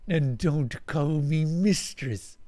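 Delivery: slow, growled alien order; a mild alien growl at a servant. Acted. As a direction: growl the words slowly. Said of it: "practically growling more than talking now".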